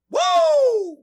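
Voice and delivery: high, loud, hype-man whoop; excited whoop. Real, not acted. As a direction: whoop it up for the crowd. Hyping up the show.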